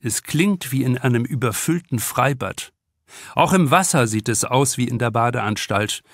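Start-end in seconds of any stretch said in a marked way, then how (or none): none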